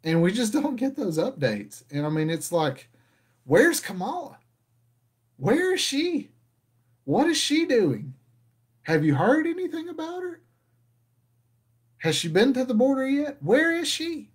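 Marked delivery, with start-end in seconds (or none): 3.4-14.3 mock searching call. Vlogger mock calling out for Kamala, high. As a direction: pretend to look around for her, pitched way up.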